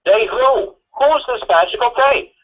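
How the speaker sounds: high, incredulous cross-examination; exasperated high-pitched questioning; incredulous demand. Pretend. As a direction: press the lawyer in mock disbelief. Mock cross-examining the lawyer over they.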